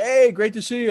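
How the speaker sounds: high, loud imitation of a glad hello; hearty greeting. Player hugging a new teammate hello, hearty greeting. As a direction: burst out with an effusive welcome.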